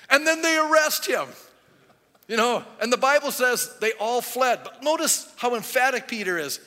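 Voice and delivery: loud, high-pitched sermon delivery; full-force preaching. Acted. Preacher telling of Jesus' arrest, full-force preaching.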